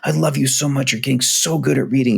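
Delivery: lavish her with praise, voice up high; gushing praise. Retold or imitated. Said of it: "lavish incredible praise on her".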